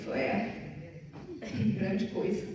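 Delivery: low, soft, unimpressed shrug; dismissive friend. Retold or imitated. Friend answers the good news, quiet and dismissive. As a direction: brush it off as no big deal. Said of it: "o fulano olha assim".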